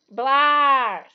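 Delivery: loud blah of disgust; disgusted spit. Acted. Ron biting a sprout bean, loud disgusted blah. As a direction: spit out the bad taste.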